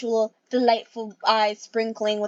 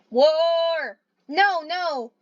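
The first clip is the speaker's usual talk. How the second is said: screaming war; 6 semitones higher, much slower than usual. A narrator's reading. Leader screams for battle at the gathering. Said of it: "Horn Star, screaming".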